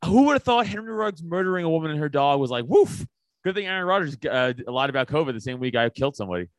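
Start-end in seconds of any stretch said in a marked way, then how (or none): 2.7-3.1 sudden high yelped "woof"; mock dog woof. Host mocks how the league shrugged off killing, with a yelped woof. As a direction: toss off a silly little bark. Mocking a killing in retold talk.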